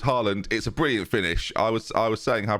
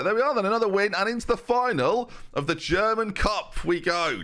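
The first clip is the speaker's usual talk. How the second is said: triumphant commentary; 8 semitones higher than usual. Real, not acted. Celebrates reaching cup final.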